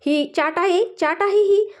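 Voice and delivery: high-pitched sing-song copy of a sulky complaint; mocking mimicry. A narrator's reading. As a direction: mimic the sulker to tease. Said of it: "प्रियाची नक्कल करू लागले".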